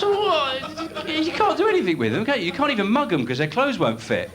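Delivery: squeaky, high incredulous rant; indignant thought. Retold or imitated. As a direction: squeal it up in disbelief. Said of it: "I thought".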